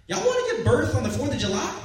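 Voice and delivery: put-on heavy Texas nurse accent, upbeat; Texan accent. Nurse offering a Fourth of July birth, Texan accent. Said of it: "She has this heavy Texan accent".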